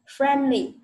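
FRIENDLY said clearly and firmly; model pronunciation. Shown as a demonstration. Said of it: "gần đúng nhá". (đọc mẫu "Friendly" rõ ràng)

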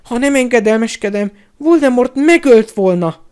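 high, loud whimper; wailing lament. A narrator's reading. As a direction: wail it pleadingly. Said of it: "siránkozott Pettigrew".

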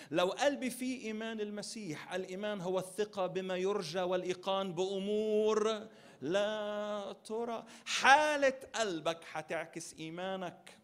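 loud, high climax of a sermon; preaching at full force. Acted. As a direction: proclaim it to the congregation.

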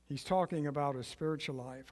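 usual speaking voice

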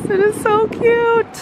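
high, slow, gushing delight; excited squeal. Real, not acted. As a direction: squeal over something adorable. Seeing the hotel lobby.

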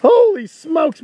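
sudden high excited outcry, laughing. Real, not acted. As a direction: yelp it out, then drag out Holy smokes. Turtle near feeding croc.

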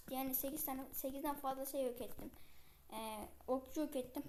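normal talking voice

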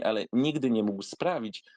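usual speaking voice

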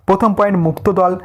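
usual speaking voice

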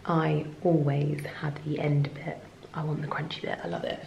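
soft whisper; hushed, breathy aside. Real, not acted. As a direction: whisper it conspiratorially. Whispering about crunchy end.

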